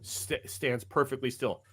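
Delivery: normal talking voice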